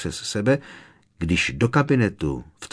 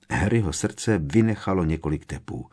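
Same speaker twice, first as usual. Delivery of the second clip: usual speaking voice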